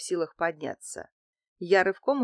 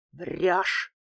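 ordinary delivery

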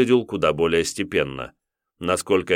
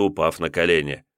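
ordinary delivery, nothing marked

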